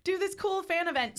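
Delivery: high, bright promo voice; playing the hyped organizer; mock announcement voice. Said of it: "I guess that they were like".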